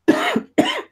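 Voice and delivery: sudden coughing fit; choking on a drink. Real, not acted. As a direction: cough hard after a swallow went down wrong. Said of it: "I'm choking on my Guinness".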